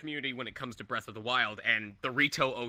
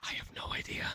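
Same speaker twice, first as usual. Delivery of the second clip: hushed, voiceless whisper; whispered comic confession; 7 dB quieter than usual. Pretend. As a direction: drop to a soft whisper. Joking answer, drops to a whisper.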